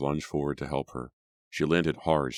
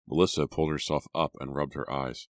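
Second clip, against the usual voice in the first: ordinary delivery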